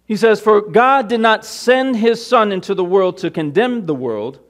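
loud, high-pitched sermon delivery; forceful preaching. Real, not acted. Preacher reading John three to the congregation, full-force preaching. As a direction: proclaim it to the congregation, drawing out key words.

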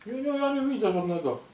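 tiny high voice; squeaky high character voice. Pretend. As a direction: squeak it out like a small toy. Spy character answers the villains.

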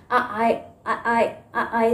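mild sailor's aye aye. Shown as a demonstration. Teacher showing the sailor action with children. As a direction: answer a ship message with aye aye. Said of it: "कुछ message पास कर रहे हैं".

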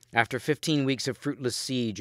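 ordinary delivery

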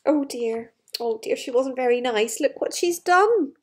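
plain reading voice

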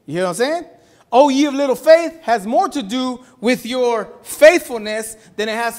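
loud, high, driving sermon; preaching at full force. Real, not acted. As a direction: preach it hard to the congregation.

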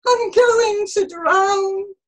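high, loud, slow lament; groaning wail. A narrator's reading. Jonah fearing he will drown, groaning. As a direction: stretch it into a despairing wail. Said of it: "groaned Jonah".

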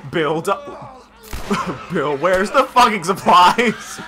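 loud, slow exasperated shout; laughing yell. Real, not acted. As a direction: yell at him half laughing. Yelling at game ally.

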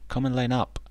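a hint of singsong mothering; mother duck calling. A narrator's reading. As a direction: summon the little ones in a lifted voice. Mama Duck calling her ducklings.

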